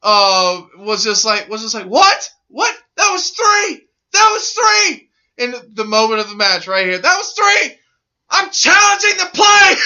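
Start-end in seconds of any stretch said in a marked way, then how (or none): 1.9-5.1 mock outraged protest. Wrestler protesting the referee's three count, mock outraged. 7.0-9.7 high shouted protest; mock outraged shout. Wrestler shouting at the referee to challenge the play, hammed-up yell.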